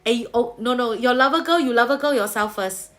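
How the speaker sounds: ordinary delivery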